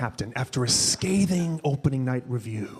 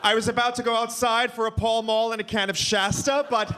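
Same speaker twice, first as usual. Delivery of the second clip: high, theatrical scolding rising to a shout; Broadway choreographer yelling; 9 semitones higher than usual. Retold or imitated. Dad scolding son like a choreographer at the dance captain, shrill and theatrical. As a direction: scold like a diva choreographer after bad reviews. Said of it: "Broadway choreographer yelling at the dance captain".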